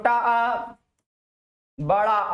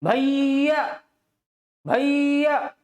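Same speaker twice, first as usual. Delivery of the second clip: भैया called out with the vowel drawn long, a bit higher; long-distance call; 3 semitones higher, much slower than usual. Shown as a demonstration. Teacher calls भैया to a brother too far to hear, long drawn vowel. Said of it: "जब इस तरीके से आप पुकारते हो".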